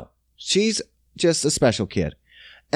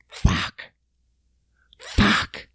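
whispered swear of dread; voiceless, acted "oh no" whisper; hushed curse; 10 semitones lower, 5 dB quieter than usual. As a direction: curse under your breath. Retold dread on seeing kids.

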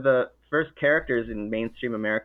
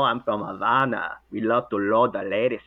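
put-on Latin lover Cuban accent. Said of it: "Latin lover Cuban accent".

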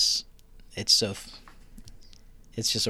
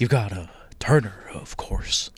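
[name]. Acted. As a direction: draw it out with an uh in the middle. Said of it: "do it in the voice, please".

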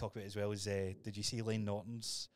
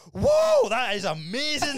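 very high, loud, slow gasp of wonder; hammed-up ecstatic whoa at the smelling salts; loud, high mock awe; imitated rapture; 17 dB louder, much slower than usual. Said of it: "he goes".

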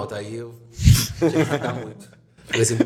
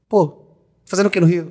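higher, louder mock Rio girl; imitated expressionless Carioca; 5 semitones higher, 6 dB louder than usual. Retelling a girl met in Rio, imitated Carioca deadpan, pitched up. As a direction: imitate a Carioca girl's unenthusiastic question. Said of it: "Desse jeito".

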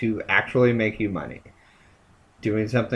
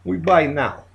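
put-on Russian accent; 5 semitones higher than usual. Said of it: "I could talk in a Russian accent".

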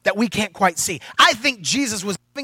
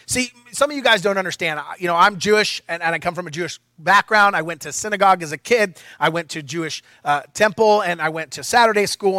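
normal talking voice

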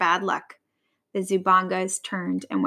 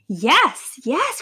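excited cry; 4 semitones higher than usual. A narrator's reading. Vulture seizing on the idea, excited cry. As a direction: leap up in pitch with delight. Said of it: "cried Vera".

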